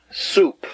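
"Soup" whispered, unvoiced. Shown as a demonstration. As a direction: breathe out "Soup" with no voice. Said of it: "That, that's the sound".

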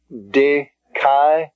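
di-kai-o-su-ne split slowly into syllables. Shown as a demonstration. Teacher dividing dikaiosune for students. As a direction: break it into syllables. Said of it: "Next word is di".

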